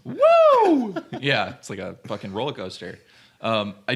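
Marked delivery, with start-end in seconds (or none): 0.0-0.9 whooping cheer. Real, not acted. Cheering a roller coaster joke.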